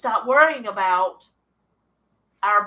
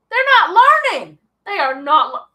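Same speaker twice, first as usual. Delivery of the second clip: much higher and louder, rushed; mock exasperated outburst; 7 semitones higher, 11 dB louder than usual. Preacher acting Moses fed up with the stubborn people, loud and exasperated. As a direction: vent like a leader fed up with his people.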